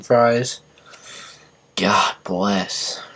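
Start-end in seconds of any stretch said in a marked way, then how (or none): 1.7-3.0 whispered. Real, not acted. Muttered after counting fries.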